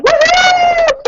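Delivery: high loud celebratory woohoo; cheer. Real, not acted. Leader cheering her gold medalist, loud woohoo. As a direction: let out a big woohoo. Cheering Princess Court finishers.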